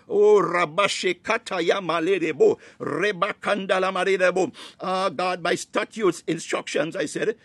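ordinary talk

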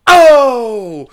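loud, high, drawn-out "oh"; crowd roar. Retold or imitated. Crowd reacts to the wrong mic dropped, long loud "oh". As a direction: imitate a whole arena groaning out "oh". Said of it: "And the whole crowd goes".

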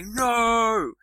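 a loud cry of dismay; upset no. Shown as a demonstration. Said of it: "if I'm very upset about something".

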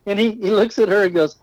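ordinary delivery

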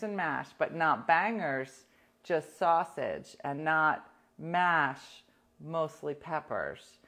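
slow, deliberate sarcastic listing; mocking her friend's menu plan; mock sarcastic spelling out. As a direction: drag each item out sarcastically. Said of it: "I'm like".

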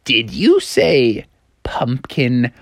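very slow, drawn-out eager question; eager Froggy. Acted. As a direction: stretch every word, rising on the last. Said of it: "And Froggy was like".

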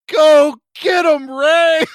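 high, slow, loud rallying yell; hammed-up battle cry; rousing old-lady cheer. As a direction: holler it like a granny egging on a hero. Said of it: "She comes out and she goes".

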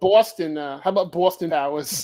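lightly put-on Boston accent; rough Boston accent. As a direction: say it with a hint of Boston. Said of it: "what you think a Boston accent sounds like".

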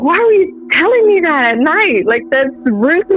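high-pitched retold complaint; mildly exasperated, rising pitch; indignant scolding. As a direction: act out telling off a sister. Acted morning quarrel with sister.

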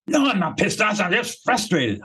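annoyed client insisting she's only frustrated; defensive client. Acted. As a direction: snap back like an annoyed client who won't admit it. Acted client denying anger.

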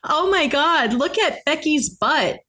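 usual speaking voice

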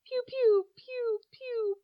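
high laser pews fading to a whisper; pew sounds. Pretend. Rating the movie with mock laser shots: high pews fading to a whisper. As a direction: fire little pews, last ones whispered. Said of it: "four and a half pews".

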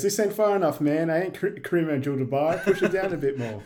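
mock exasperated Carter, laughing at the end; mock film complaint. Quoting Carter's loud film complaint.

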